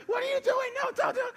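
very high, loud frantic mom voice; imitated alarm. Mom running downstairs to rescue child, alarmed. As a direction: shriek in mock alarm to stop him. Mom rushes in to stop him.

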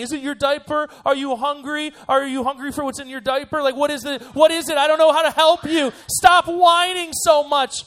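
frantic pleading building to a loud, high shout; exasperated parent. Acted. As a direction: beg for answers, then explode in hammed-up despair. Exasperated parent pleading with whining kids.

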